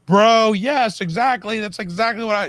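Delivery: high, loud, thrilled outburst; excited shout to himself. Retold or imitated. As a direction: yell it like you finally get it. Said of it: "talking to myself".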